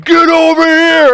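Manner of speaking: loud, high, drawn-out yell into the phone; acted panicked scream. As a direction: bellow an urgent order, stretching the words. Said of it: "he's screaming at me".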